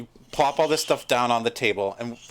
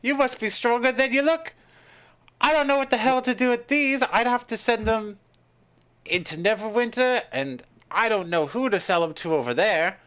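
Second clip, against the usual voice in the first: shopkeeper's put-on accent; 8 semitones higher than usual. Shopkeeper unsure where to sell manticore parts, high character voice. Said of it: "I got lost in the accent".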